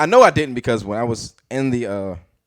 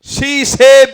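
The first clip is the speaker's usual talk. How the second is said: loud, high-pitched comedian impression; Bernie Mac; 11 dB louder than usual. Comic rereads the quote as an adult, loud high impression. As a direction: belt it out as a brash adult comic. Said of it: "reread it as Bernie Mac's voice".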